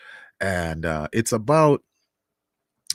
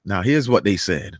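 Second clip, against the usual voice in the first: normal talking voice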